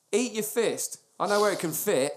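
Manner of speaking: ordinary delivery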